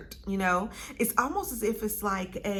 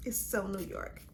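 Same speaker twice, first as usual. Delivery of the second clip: quiet swoon; 8 dB quieter than usual. Real, not acted. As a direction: say it softly to yourself, savoring it. Savoring the scent, then building up.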